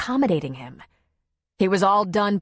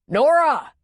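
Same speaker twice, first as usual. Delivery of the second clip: loud, high call of a name; urgent yell; 8 semitones higher, 5 dB louder than usual. A narrator's reading. Tom calls to Nora as he runs to help, urgent yell. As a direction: yell out to let her know help is coming. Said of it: "he yelled just so".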